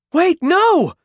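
high, loud desperate cry. Acted. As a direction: call out in panic. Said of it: "Caro cried".

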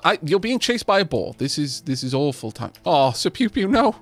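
ordinary talk, nothing marked

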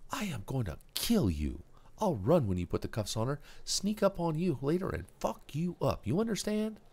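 put-on high, cocky kid making a threat; a punk kid trying to sound dangerous; Kuno threat.

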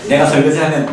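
ordinary delivery

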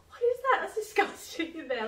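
high-pitched, appalled squeal easing downward; disgusted outburst. Retold or imitated. Patient reacting to her arm, mock-horrified disgust. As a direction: start high and loud, recoiling in disgust.

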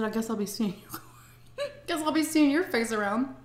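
bitter laugh through the words; laughing sarcastic. Retold or imitated. Leaving the attorney's office, laughing sarcastic goodbye.

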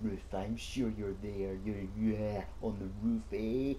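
hushed, knowing taunt; sly murmur. Pretend. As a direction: murmur like you've caught them. Taunting hidden player.

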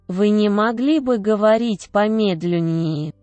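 ordinary delivery

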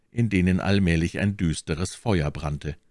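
plain reading voice

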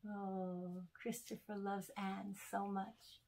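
ordinary delivery, nothing marked

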